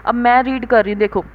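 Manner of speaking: usual speaking voice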